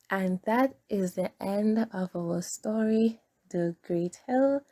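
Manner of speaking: usual speaking voice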